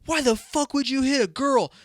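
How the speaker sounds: high, sharp mock outrage; shocked bystanders yelling at him; outraged crowd. Crowd turning on the guy who hit a girl, mock outrage. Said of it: "they're like".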